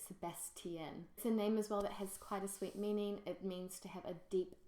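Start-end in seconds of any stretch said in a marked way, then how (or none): 0.1-1.1 Sebastian said slowly the French way, stress on the last syllable; slow, careful French Sebastian; French pronunciation. Shown as a demonstration. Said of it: "say it in the French way".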